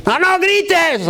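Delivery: drawn-out, high-pitched acted shout; hammed-up high yell; mock yelling parent. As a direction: imitate a shouting parent, stretching the words. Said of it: "¿Cuántos gritones hay aquí?".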